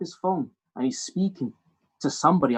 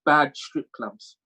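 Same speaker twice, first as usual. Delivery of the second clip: ordinary delivery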